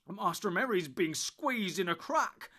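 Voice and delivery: loud, high, slow urgent cry; alarmed cry. Acted. Sam crying to Tom about Merry. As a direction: shout the danger out. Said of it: "cried Sam".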